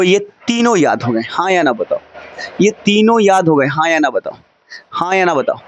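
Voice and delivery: normal talking voice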